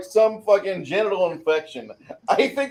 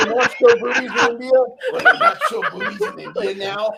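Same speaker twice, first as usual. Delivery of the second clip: put-on laughing Indian accent; hammy Indian accent through laughter; 4 semitones higher than usual. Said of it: "went all the way with the Indian voice".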